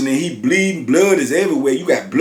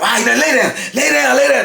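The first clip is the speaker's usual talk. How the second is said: tough-guy orders; 9 semitones higher, faster than usual. Retold or imitated. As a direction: snap it like guards who finally feel safe. Guards ordering him down.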